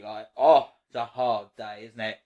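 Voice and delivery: low, hushed sympathetic murmur; soft sympathy. Real, not acted. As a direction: drop low and soft for a tired dog. Soothing sleepy dog she disturbed.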